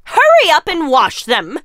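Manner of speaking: loud, high, barking orders; bossy stepmother. Acted. Stepmother ordering Cinderella to do laundry, loud and bossy. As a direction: shout chores at the maid.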